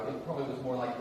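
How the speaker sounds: ordinary delivery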